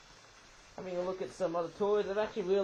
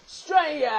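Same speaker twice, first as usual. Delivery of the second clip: loud, high cry of "Straya!"; playful Aussie cheer, pitched high; exclaimed Straya; 7 semitones higher, 7 dB louder than usual. Real, not acted. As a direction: belt out the slangy two-syllable "Straya". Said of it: "if you say the word Australia".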